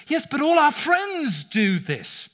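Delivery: a hint of a child pleading their case; teen child pushing back at a parent; teenager protesting. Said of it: "very often they would say to us".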